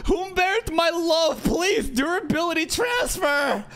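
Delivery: high, shrill mock begging; pleading damsel. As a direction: beg in a high whiny voice. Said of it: "Raz is gonna be like".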